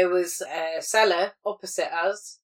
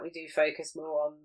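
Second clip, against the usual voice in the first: ordinary talk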